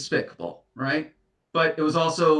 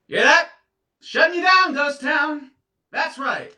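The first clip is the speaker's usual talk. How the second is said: much higher and louder, crowing it out; triumphant shout; 13 semitones higher, 6 dB louder than usual. Acted. Declaring to her ex she's done with ghosting, crowing loudly. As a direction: announce your freedom to the whole room.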